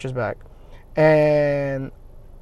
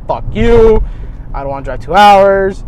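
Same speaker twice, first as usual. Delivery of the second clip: irritated; 3 semitones higher, 9 dB louder than usual. Retold or imitated. I got irritated because.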